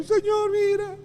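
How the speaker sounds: very high, loud mock voice; mock call to God. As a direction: pipe up like someone showing God a find. Acted plea of believers diving up sins. (vocecita aguda llamando al Señor)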